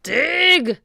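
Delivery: high, loud pirate bellow; shouted order. A narrator's reading. Captain ordering the pirates to dig, shouting. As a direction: bellow the command. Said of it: "shouted Cap'n Bones".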